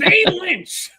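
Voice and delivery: high loud squawking comic; Gilbert Gottfried. Pretend. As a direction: yell the name like Gilbert. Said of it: "I could Gilbert Godfrey".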